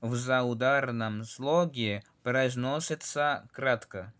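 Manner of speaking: в заударном слоге произносится кратко read slowly, word by word; slow Russian reading. Shown as a demonstration. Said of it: "aquí al lado dice glasny".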